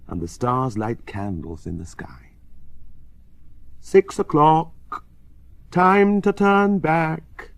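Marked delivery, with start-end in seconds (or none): 3.8-7.5 slow, ringing, high and loud; clear clock chimes. A narrator's reading. Chimes telling children to turn back, slow and clear. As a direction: toll the words out like chimes. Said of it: "the chimes say clearly".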